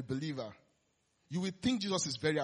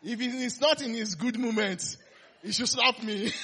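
louder, laughing through a joke; laughing aloud; 6 semitones higher, 6 dB louder than usual. Real, not acted. Preacher joking to the congregation, laughing and louder. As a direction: laugh as you picture getting slapped. Joking about telling pastor.

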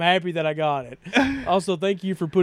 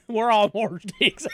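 mock American accent; 6 semitones higher than usual. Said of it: "You can do accents of us".

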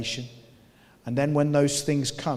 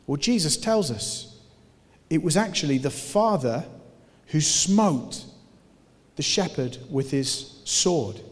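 ordinary talk, nothing marked

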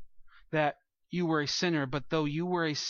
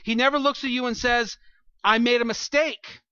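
rising preaching intensity; 7 semitones higher, 7 dB louder than usual. Acted. Preacher builds to emphatic climax.

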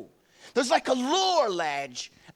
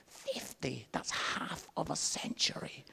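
soft, half-whispered amazement; hushed disbelief; 4 semitones lower, 9 dB quieter than usual. Acted. Preacher owning up to turning fifty, hushed disbelief. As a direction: let the age sink in, almost whispered. Mock awe at own age.

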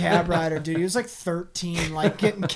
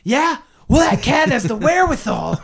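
mock shout; 8 semitones higher, 7 dB louder than usual. Playing the neighbor's retort.

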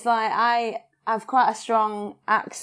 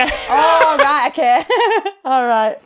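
laughing it off, voice up; chuckling through the words right, okay; 7 semitones higher, 6 dB louder, slower than usual. Real, not acted. Realizing her mistake, laughing to the host.

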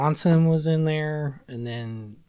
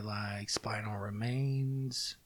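whispering; 4 semitones lower, 13 dB quieter, much slower than usual. Real, not acted. Whispered song title.

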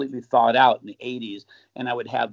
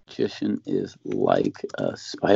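much lower, slow movie-trailer read of Magician is Like a Spy; dramatic title voice; slower than usual. Shown as a demonstration. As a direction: announce it like a film trailer. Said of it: "Let's see, How a".

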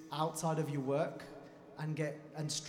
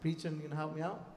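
usual speaking voice

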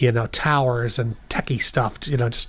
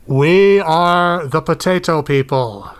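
alien announcer; 3 semitones higher, 7 dB louder, much slower than usual. Acted. Alien announcing over speakers.

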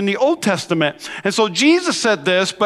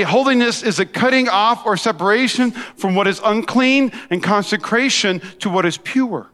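ordinary talk, nothing marked

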